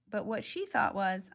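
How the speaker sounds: ordinary delivery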